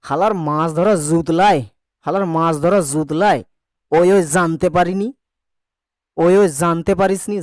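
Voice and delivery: put-on rough Old Dhaka street accent, zut and zante; Dhaka dialect. Shown as a demonstration. Teacher to pupils, quoting a fisherman in Old Dhaka accent. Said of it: "এটাকে যদি ঘৃষ্ট করতে হবে".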